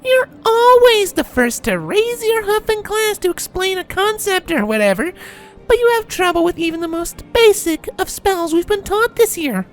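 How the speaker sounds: very high whining filly voice; irritating whine. A narrator's reading. Filly mocks colt's trouble with basic spells, irritating whine. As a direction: needle him with a grating high whine. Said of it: "spoke in an intentionally irritating whine".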